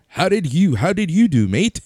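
high, playful fake British accent; mock British accent. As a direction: lightly ham up a fake British accent asking. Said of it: "that fake British accent".

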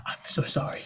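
tiny, whispered plea; whispered apology. Acted. Prisoner apologizes to his interrogator, whispering. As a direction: whisper it as a cowed captive.

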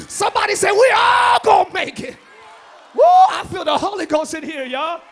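loud, rousing call to the church; full-force preaching. Acted. Preacher to congregation calling them blessed, rousing. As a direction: lift them up and shout it.

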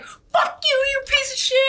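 very high, squealed insult; hammed-up high-pitched fury; mock outraged cursing. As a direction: imitate an indignant guy lashing out. Retelling bar guy's outraged reaction.

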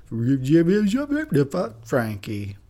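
garbled reversed speech; backwards speech. Shown as a demonstration. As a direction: say it as if played in reverse. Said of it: "I was talking backwards".

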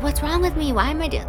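retold self-doubt, pitched well up; anxious and high, pleading with herself; insecure, high. Telling the host how insecure she felt, anxious high voice. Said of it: "feeling insecure".

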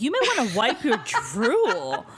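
teasing, laughing through it. Retold or imitated. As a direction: crack up mid-line. Retelling drooling FaceTime guy.

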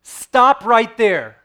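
put-on loud alarmed shout of a child's name; yell. Father yelling at his daughter about to run into the street, loud shout. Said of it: "I yelled her name".

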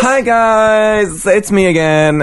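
put-on high, bright gamer-girl greeting; peppy YouTuber voice. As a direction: chirp it like a hyped streamer. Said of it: "that exact thing where it's like".

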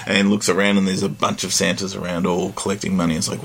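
usual speaking voice

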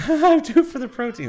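high, laughing repeat. Real, not acted. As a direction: crack up while echoing the line. Laughing echo of joke.

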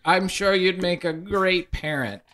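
pry kindly like a busybody aunt; nosy ghost. Acted. Ghost consoling the paladin, high and chirpy.

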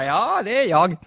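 high-pitched answering call; calling back. Acted. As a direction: call back in reply from a distance. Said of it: "Och hon ropar".

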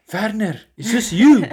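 loud, high accusing question; irritated shout. Retold or imitated. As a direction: call him out angrily. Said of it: "he's like".

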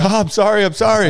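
very high, loud panicky apology; flustered apology. Retold or imitated. Coworker caught out apologizing, high mock panic. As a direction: back off fast and squeaky. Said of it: "He's like".

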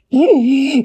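very high loud shriek; spider shriek. A narrator's reading. Spiders cry out.

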